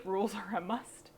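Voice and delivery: ordinary talk, nothing marked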